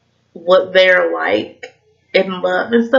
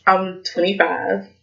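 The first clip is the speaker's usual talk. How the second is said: normal talking voice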